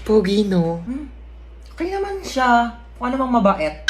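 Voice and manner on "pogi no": ordinary talk, nothing marked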